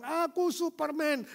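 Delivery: put-on high, playful child voice; child shouting. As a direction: play the proud little boy. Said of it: "Waktu dia teriak kencang".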